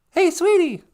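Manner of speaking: high, loud falsetto call; put-on high voice. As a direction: sing out sweetly in falsetto. Put-on voice calling host.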